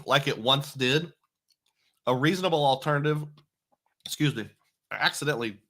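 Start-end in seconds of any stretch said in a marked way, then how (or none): 2.0-5.6 talking around a piece of ice; words blurred by ice in mouth. Real, not acted. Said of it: "which is why I seemed real weird there".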